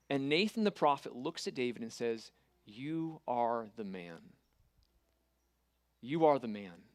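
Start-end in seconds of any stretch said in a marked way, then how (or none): 2.6-4.3 very slow, low and soft verdict; slow solemn accusation. Retold or imitated. Prophet confronts David. 6.0-6.8 "are" pressed firmly, falling at the end; stress on are. Retold or imitated. Prophet repeats the accusation.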